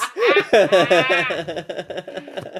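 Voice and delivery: loud burst of laughter; laughing. Real, not acted. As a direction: laugh hard at the joke. Laughing at joke.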